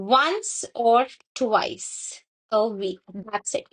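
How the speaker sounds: once or twice a week drawn out very slowly; deliberate slow stress on the limit; slow emphasis. Shown as a demonstration.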